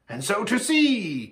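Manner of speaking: high exultant call; elated rallying cry; joyful cry. A narrator's reading. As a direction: cry out, ready to dance. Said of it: "cried Tumnus".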